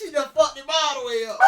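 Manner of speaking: high, loud laughing shout; laughing hard. Real, not acted. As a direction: crack up while teasing.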